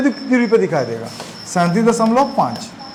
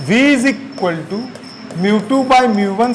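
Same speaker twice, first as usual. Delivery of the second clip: slow dictation; slower than usual. Shown as a demonstration. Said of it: "dictating formula slowly".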